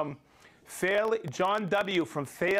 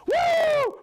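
triumphant whoop; 7 dB louder than usual. Real, not acted. Celebrating getting the name right.

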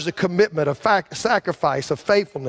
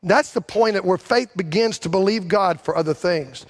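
preaching at full force; 5 semitones higher than usual. Acted. Pastor urging the congregation to believe God loves them, in full preaching force. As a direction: drive it home like a revival preacher.

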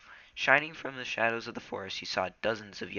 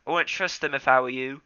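doubtful; 5 semitones higher, 9 dB louder than usual. Acted. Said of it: "mewed doubtfully".

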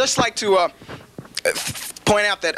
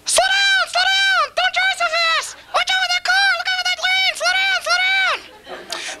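shrill falsetto panic, far higher, slow at the end; mother's voice; slower than usual. Retold or imitated. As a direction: squeal like a terrified mom in the passenger seat. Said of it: "my impersonation, my father's face and my mother's voice".

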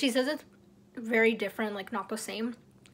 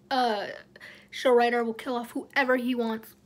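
usual speaking voice

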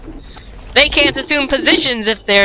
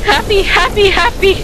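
high cheerful chant; excited; 8 semitones higher than usual. Acted. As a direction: bounce on each happy.

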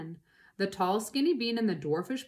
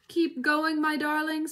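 lightly acted, higher urging voice; panting; 7 semitones higher, 4 dB louder than usual. A narrator's reading. Mister Fox urging his darlings on, lightly panted. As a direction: urge them on as if winded. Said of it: "panted Mister Fox".

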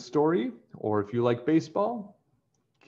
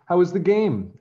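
higher, louder mother asking cheerfully; mother's voice; 8 semitones higher, 7 dB louder than usual. A narrator's reading. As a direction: sound like a mom greeting her son. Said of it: "asked his mother".

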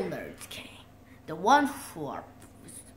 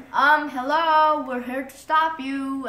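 confident heroes; 4 semitones higher, 6 dB louder than usual. Retold or imitated. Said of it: "elementary schoolers are like".

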